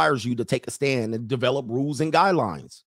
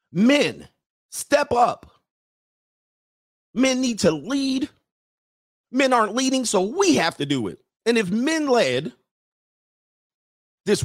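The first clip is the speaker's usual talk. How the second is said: high, mocking lady voice; mock woman's voice; 9 semitones higher than usual. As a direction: parody women insisting men step up.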